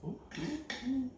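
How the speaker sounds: squeaky high ooh of delight; high excited oohs. Real, not acted. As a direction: coo high and soft at the taste. First bite of hot bake.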